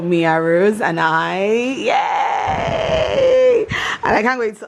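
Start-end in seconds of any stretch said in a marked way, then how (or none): 1.4-3.7 high happy shout; excited cheer. Real, not acted. Cheering about package.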